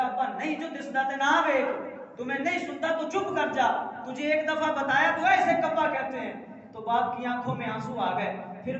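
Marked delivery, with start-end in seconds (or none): 0.0-6.3 put-on snapping irritably at his old father; impatient son telling father to hush; angry son. Son fed up with his father's repeated question, angry. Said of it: "غصے میں آ گیا اور کہنے لگا".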